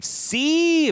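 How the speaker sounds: very high, loud, held-out note; exaggerated "see". Mocking a movie making its point, high showy cry. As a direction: crow it high, as if rubbing it in.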